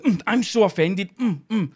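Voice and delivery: high, laughing mock offence; mock Sturgeon. Mocking Sturgeon's fake offence, high-pitched. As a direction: whine it sarcastically like Sturgeon. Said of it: "Sturgeon was like".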